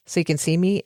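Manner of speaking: normal talking voice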